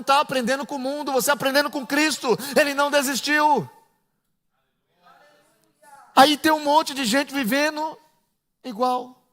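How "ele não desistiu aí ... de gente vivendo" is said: ordinary talk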